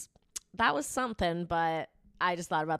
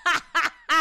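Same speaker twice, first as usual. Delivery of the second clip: bursting into loud laughter; laughing; 9 semitones higher, 9 dB louder than usual. Real, not acted. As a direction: laugh out hard at the irony.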